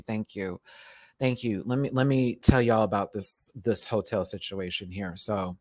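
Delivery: ordinary talk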